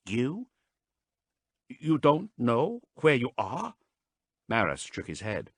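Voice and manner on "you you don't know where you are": higher, slow disbelief; can't believe what she hears; incredulous. A narrator's reading. Haas incredulous at Marast, higher and slow.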